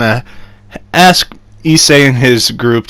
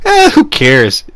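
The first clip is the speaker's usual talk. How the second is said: mildly mocked shrug; 5 semitones higher, slower than usual. Voicing dismissive Zenovia in retelling.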